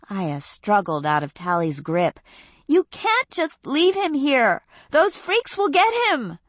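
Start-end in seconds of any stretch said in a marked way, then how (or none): 2.7-6.4 put-on much higher, urgent young girl; pleading Aya. A narrator's reading. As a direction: beg her not to leave him.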